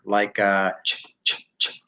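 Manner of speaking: "ch" as a bare whispered puff, no voice; voiceless CH. Shown as a demonstration. Teacher showing learners sounds without vocal vibration, voiceless. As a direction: repeat "ch" like a whisper, voice switched off. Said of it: "they're like a whisper".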